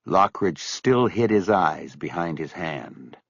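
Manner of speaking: ordinary delivery, nothing marked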